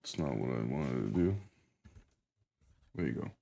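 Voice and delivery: low soft grumble; quiet. Real, not acted. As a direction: mumble it to yourself. Mumbled aside, disappointed.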